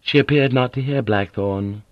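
usual speaking voice

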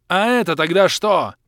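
loud, high angry shout; furious shout at the creature. Acted. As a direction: yell it in fury. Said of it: "заорал я".

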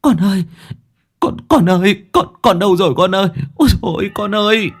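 high-pitched wail, words repeated; panicked, wailing mother's calls; frantic screaming mother. Acted. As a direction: cry out desperately for your lost child. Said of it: "mò mẫm ở dưới hồ hoa súng mà gào thét".

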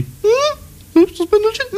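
tiny falsetto squeal of a voice; absurdly high, strained doubter; squeaky mock sceptic. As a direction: squeeze it out very high, as a caricature. Mock sceptic answering a simple question.

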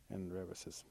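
ordinary delivery, nothing marked

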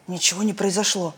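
ordinary delivery, nothing marked

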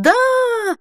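very high, long imagined shriek; drawn-out yell. A narrator's reading. Imagined saleswoman leaping to seal the sale, yelling. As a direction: stretch one high, whooping yes. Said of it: "с протяжным воплем".

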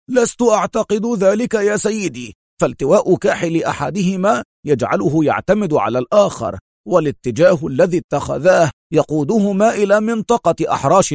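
high-pitched tracker's explanation; measured Dimal. A narrator's reading. Tracker explains the trail to his boss, high-pitched. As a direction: reason it out in a high voice. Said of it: "ثم أجاب في هدوء".

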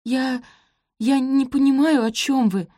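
high, faltering denial; timid babble. A narrator's reading. Sophie denies borrowing the shoes, babbling. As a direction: stammer innocently, pitch up. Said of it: "пролепетала она".

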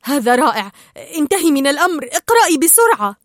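loud, tense urging. A narrator's reading. Marlin hurries Dory as danger nears, tense. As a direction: push them along loudly, voice rising. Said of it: "فقال مارلين في توتر".

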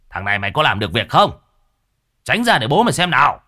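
scold, then shout him out of the way; rising, irritated command; impatient father. Acted. Father impatiently orders son aside.

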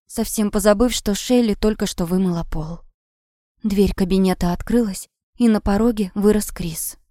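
ordinary delivery

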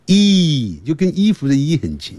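normal talking voice

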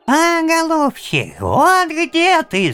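very high, loud squeak; squeaking bird beak. Acted. Bird's beak mocking grandfather, high squeak. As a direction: squeak it like a beaked creature. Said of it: "запищал птичий нос".

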